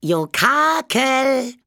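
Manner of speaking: high, loud, drawn-out spook cry; unheimlicher Schrei. A narrator's reading. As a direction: wail it out so it echoes down the corridors. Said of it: "die unheimlichen Schreie". (unheimlicher, lang gezogener Spukschrei)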